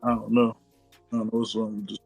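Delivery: ordinary talk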